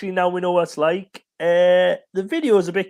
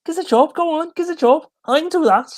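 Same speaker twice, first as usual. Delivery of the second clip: higher, begging Scouse voice; Scouse accent; 9 semitones higher than usual. Retold or imitated. Host repeating the Scouse jobseeker line, higher Scouse pleading. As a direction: plead "gives a job" like a desperate Liverpudlian. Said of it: "Scouse accent".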